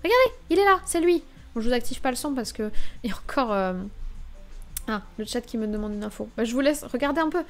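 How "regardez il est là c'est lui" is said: high, loud burst of excitement; eager "look, there he is" call; excited pointing out. Real, not acted. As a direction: call out higher and louder, pointing him out. Spots Jason Statham in the trailer.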